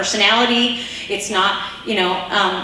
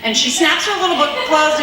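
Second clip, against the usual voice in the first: ordinary talk, nothing marked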